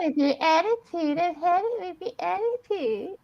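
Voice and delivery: high mock-whiny echo; swooping high-pitched mockery; mocking imitation. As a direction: parrot the complaint back in a high voice. Mimics the other speaker's complaint.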